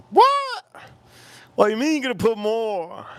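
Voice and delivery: exaggerated high-pitched disbelief; mock outrage. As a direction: yelp "what" way up high, then protest. Retold shock at date's offer.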